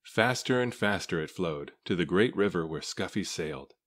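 ordinary delivery, nothing marked

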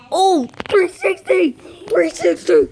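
high, slow, stretched shout of delight; thrilled, stretched-out cheer; excited slow shout. Real, not acted. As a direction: cheer the move, drawing it out. Excited at a game dunk.

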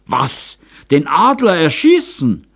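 high, loud shocked question; aghast stammer. A narrator's reading. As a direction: protest in disbelief. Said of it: "stotterte Moser". (hohe, entsetzte Nachfrage)

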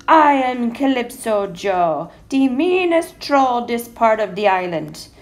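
big, slow, booming troll roar; troll's roar. A narrator's reading. Mean troll warning anyone crossing his bridge, roaring slowly. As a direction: roar it out loud, stretching the words. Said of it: "he would roar".

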